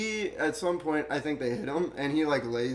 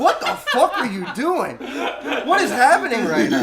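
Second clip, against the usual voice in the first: disbelief; 8 semitones higher, 6 dB louder than usual. Retold or imitated. Shocked reaction to friend yelling.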